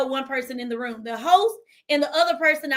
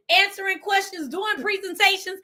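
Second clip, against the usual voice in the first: normal talking voice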